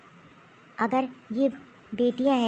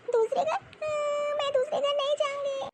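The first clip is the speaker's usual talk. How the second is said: put-on much higher child voice, दूसरे घर rising in shock; upset little girl; 13 semitones higher than usual. Little girl refusing to leave home, high and hurt.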